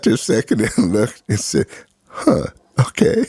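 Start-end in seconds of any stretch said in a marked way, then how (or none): 2.1-3.2 surprised realization. Retold or imitated. Professor reconsidering, surprised.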